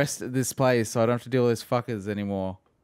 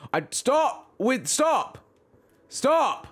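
much higher, strained cries of stop; high frustrated yelp; 13 semitones higher, much slower than usual. Real, not acted. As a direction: plead with the broken controller in a high voice. Yelling at the controller.

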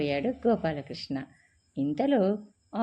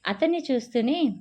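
plain reading voice

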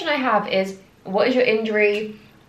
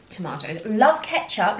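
usual speaking voice

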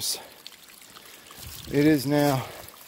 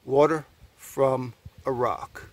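slow, word by word with pauses; slow wonder; 3 semitones lower, 4 dB quieter than usual. Real, not acted. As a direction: let each part land separately. Repeats phrase word by word.

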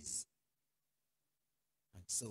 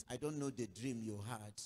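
ordinary delivery

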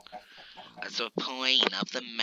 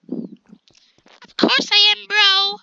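much higher, slow and louder; mock chipmunk voice; squeaky high voice; 7 dB louder, slower than usual. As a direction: stretch it out in a cartoon squeak. Joking with friends, squeaky voice.

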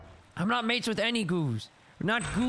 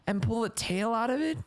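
ordinary talk

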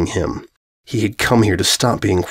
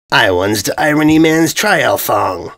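proud; 3 semitones higher, slower than usual. A narrator's reading.

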